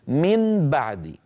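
من بعد slow, with a laboured distinct N; clear unmerged nun. Shown as a demonstration. Teacher proves the transition is heavy, clear nun. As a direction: hold a true N in من before moving to the B. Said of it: "جربوا".

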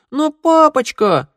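put-on high, ringing, offended child; hurt little boy. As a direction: whine it out like a wounded kid. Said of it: "голос мальчика зазвенел от обиды".

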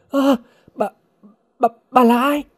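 high, startled stammer; frightened cry. A narrator's reading. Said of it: "Hồng thụt lùi hét lớn". (hoảng hốt lắp bắp, giọng cao vút)

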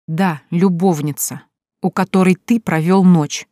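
slow, each word set apart with a pause; pause after each word. A narrator's reading. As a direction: spell it out word by word, icily. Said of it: "делая паузу после каждого слова". (медленно, с паузой после каждого слова)

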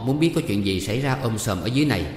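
ordinary delivery, nothing marked

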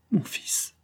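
breathless, pleading whisper; a mother whispering a last plea to her son. Acted. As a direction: beg him under her breath. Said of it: "dit-elle tout bas".

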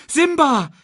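very high, loud cry; sudden high shriek of warning; terrified screech. A narrator's reading. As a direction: screech the name in alarm. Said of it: "kreischte Nala".

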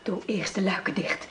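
hushed urgent whisper; breathy secret whisper. Acted. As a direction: whisper so no one outside hears. Secret night visit, shutters.

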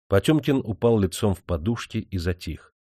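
plain reading voice

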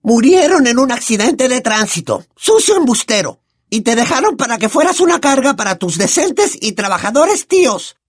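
high, loud furious aunt voice; Aunt Marge shouting. Acted. Aunt screams at Harry, high and furious. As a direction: yell the insults in a rage. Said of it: "gritó tía March".

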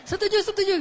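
quick, overjoyed repetition; eager yes. Retold or imitated. Mother eagerly agreeing, mocked.

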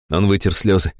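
ordinary delivery, nothing marked

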